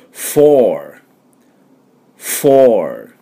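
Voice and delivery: フォー with a clear blown F and long vowel; model "four". Shown as a demonstration. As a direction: model フォー slowly for learners to repeat. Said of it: "Fの音が出てないと". (Fをはっきり出したお手本のフォー)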